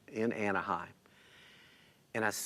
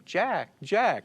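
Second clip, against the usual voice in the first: higher-pitched mock call across a crowd; retold holler; 8 semitones higher than usual. Family hailing him in Disneyland, mild holler. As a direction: imitate calling to someone far off, without full volume. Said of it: "hollering my name".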